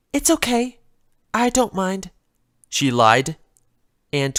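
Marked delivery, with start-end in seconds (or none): 0.1-2.1 a timid pony fretting over a broken vase; Fluttershy's voice. Acted. Fluttershy frets to herself over the vase, higher and gentle. Fluttershy lies she's fine.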